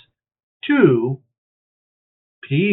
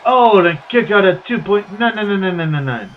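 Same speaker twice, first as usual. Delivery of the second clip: play-by-play; 4 semitones higher, 4 dB louder than usual. Acted. Play-by-play wrestling call.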